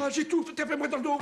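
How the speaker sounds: hammed-up wheezing, gasping for air; fake asthma attack. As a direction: fake an asthma fit, panicked. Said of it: "Est-ce que vous pourriez simuler la maladie?".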